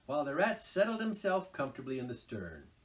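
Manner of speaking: plain reading voice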